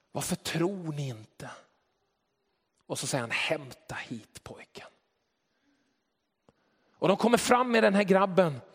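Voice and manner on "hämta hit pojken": a hushed instruction; whispered command. Retold or imitated. Jesus tells them to bring the boy, whispered. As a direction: whisper it, slow and soft.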